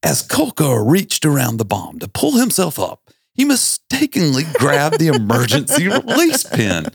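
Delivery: high, formal news anchor read; newscaster voice. Shown as a demonstration. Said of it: "I'm gonna read it in my best newscaster voice".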